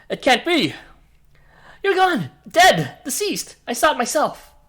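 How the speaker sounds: high-pitched, horrified denial; shock and terror. Acted. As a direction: insist desperately that it is impossible. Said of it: "shock and terror".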